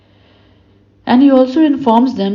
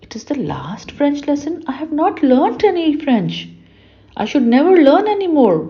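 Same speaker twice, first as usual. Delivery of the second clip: put-on dismayed boy; 6 semitones higher than usual. A narrator's reading. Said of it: "Now he's feeling bad".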